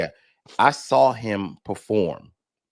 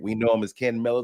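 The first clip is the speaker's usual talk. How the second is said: usual speaking voice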